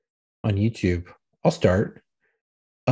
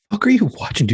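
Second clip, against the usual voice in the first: higher-pitched mock incredulous question; retold disbelief; 7 semitones higher, 3 dB louder, faster than usual. Startled man questioning his buddy in the car, retold disbelief. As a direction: act out being taken aback.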